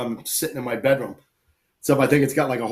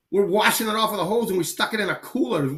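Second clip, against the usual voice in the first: high-pitched mock bewilderment; defensive protest; 7 semitones higher than usual. Hunter explaining himself to the butcher, defensive. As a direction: retell a flustered excuse, pitched up.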